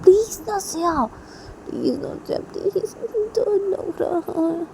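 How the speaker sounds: husband begging his wife because he is scared; scared pleading. Acted. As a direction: plead like you're terrified. Husband begs wife, afraid.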